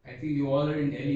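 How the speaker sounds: ordinary delivery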